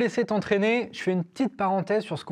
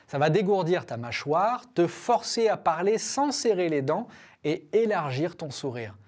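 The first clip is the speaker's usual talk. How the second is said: ordinary talk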